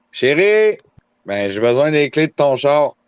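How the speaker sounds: high, loud call across the house. Real, not acted. Calling girlfriend for car keys.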